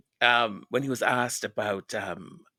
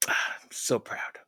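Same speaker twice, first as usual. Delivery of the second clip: whispered pride; 2 semitones lower, 5 dB quieter than usual. Real, not acted. Emotional close after tribute.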